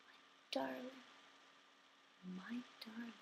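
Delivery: very soft, fading near whisper; tender recital sinking into silence; hushed poem ending. Acted. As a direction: let the last lines die away with long pauses. Closing lines of the poem.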